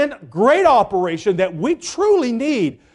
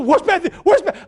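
higher repeated demand; frantic repeat; 7 semitones higher, much faster than usual. Retold or imitated. Wife still demanding her child, higher frantic repeat. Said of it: "and she's still".

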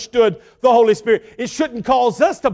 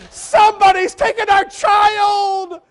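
panicked cry; 10 semitones higher, slower than usual. Retold or imitated. Wife finding the couch empty, shouting in panic. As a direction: cry it out as if your child is gone.

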